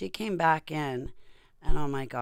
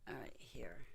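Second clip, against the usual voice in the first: whispered aside; 3 semitones lower, 16 dB quieter than usual. Real, not acted. Whispering aside while adjusting camera.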